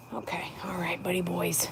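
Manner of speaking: hushed whisper to the snails. Real, not acted. Owner sneaking up on snails at night, whispering. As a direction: breathe it so nothing hears.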